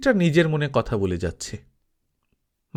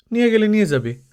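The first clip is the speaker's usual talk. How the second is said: higher and louder, throwing it back at him; defiant retort; 8 semitones higher, 4 dB louder, faster than usual. A narrator's reading. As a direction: snap back that she doesn't care.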